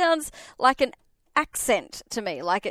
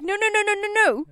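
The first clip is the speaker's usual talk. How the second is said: mock firm parent; 9 semitones higher than usual. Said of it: "I'm like".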